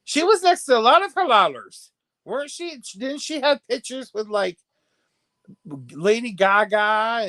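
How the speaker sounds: high, loud, animated gossip; keyed-up chatter about celebrity photos; excited gossip. Real, not acted. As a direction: gush about who she posed with.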